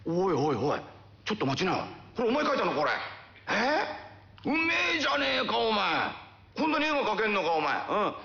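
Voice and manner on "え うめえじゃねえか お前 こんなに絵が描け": amazed praise. Acted. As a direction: blurt it out, stunned by the drawing. Uncle sees boy's drawing.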